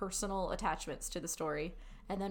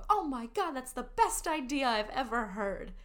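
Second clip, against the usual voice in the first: enthusiastic praise; 4 semitones higher than usual. Shown as a demonstration. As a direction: hype up the student's idea. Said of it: "if a student says something".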